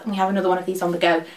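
rushed throwaway remark; tossed off at speed; very fast talking. Real, not acted. Quick throwaway aside.

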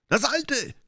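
high, lightly shouted call; a sailor's high yell; mock shout. A narrator's reading. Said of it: "schrie ein anderer". (hoher Ruf eines Matrosen)

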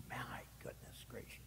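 hushed, whispered aside; appalled whisper. Real, not acted. Preacher dismayed at the priest passing by, whispering. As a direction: breathe it out under your voice.

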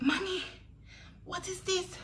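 high-pitched alarmed question to mom; high, urgent retold cry for mom; calling for mommy. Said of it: "I was like".

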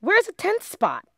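high, loud demand; high, loud, frantic; panicked shriek. Acted. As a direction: cry out in alarm. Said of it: "shrieked Lindy".

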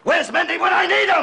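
high, full-throated shout; enraged yell. Acted. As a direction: bellow for the missing henchman. Boss rages for his missing man.

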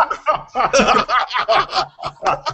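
long high-pitched laugh. Real, not acted. Laughing at the accent remark.

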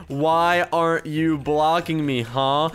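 slow, word-by-word delivery; dictating while typing. Real, not acted. Gamer typing a challenge to the hater, slow dictation. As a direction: space out each word as if typing it.

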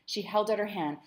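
ordinary delivery, nothing marked

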